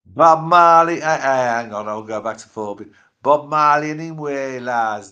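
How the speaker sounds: put-on a light Jamaican Patois accent, loud at first then fading. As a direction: chat like a Rasta, Jamaican Patois. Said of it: "if I carry on that way, I get in the shit for cultural appropriation".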